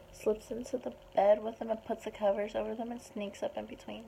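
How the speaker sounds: ordinary delivery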